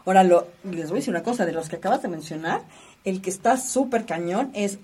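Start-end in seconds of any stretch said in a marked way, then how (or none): none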